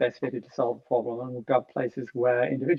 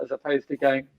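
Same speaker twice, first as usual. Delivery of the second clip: ordinary talk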